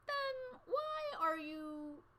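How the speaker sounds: teacher voice. A narrator's reading. Teacher cut off mid-question.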